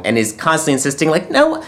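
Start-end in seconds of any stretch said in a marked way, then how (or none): 1.3-1.6 high, fast mock pleading; insistent Michael Caine. Retelling Michael Caine steering her away from home, high and rushed. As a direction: hurry him along in a squeaky put-on voice.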